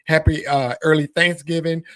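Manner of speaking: ordinary talk, nothing marked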